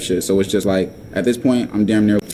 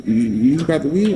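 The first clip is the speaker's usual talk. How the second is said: nagging questioner; 5 semitones higher than usual. Retold or imitated. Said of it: "she asks me again".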